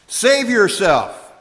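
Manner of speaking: mocking taunt. Soldiers mocking the crucified Jesus, loud jeer. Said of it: "the soldiers mocked".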